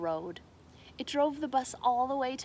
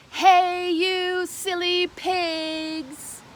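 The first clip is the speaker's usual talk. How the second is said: loud, high drawn-out yell at the pigs; 9 semitones higher, 9 dB louder, much slower than usual. A narrator's reading. Megan yells at the pigs, loud and slow. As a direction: shout it out slow at the silly pigs. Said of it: "Then Megan yelled".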